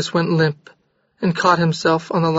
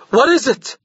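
higher, raised frantic question; shouted; 7 semitones higher than usual. A narrator's reading. Jorgis shouting at Maria in panic. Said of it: "he shouted".

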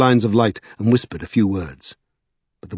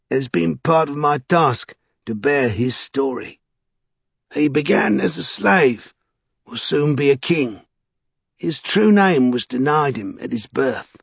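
higher, slow, portentous character voice; Vinculus the storyteller; 6 semitones higher, slower than usual. A narrator's reading. Vinculus telling of the nameless slave, slow and portentous. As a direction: draw it out like a prophecy.

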